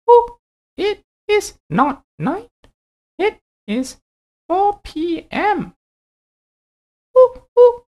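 a friendly owl, high and hooting. Acted. Owl figure voiced.

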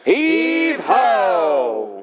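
group shouts "Heave ho!" like hauling wood; mock work shout. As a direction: heave it out together as if lifting logs. Campers act gathering wood.

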